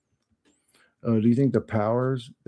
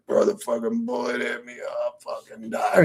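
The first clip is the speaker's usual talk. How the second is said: mock dying friend. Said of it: "He's like".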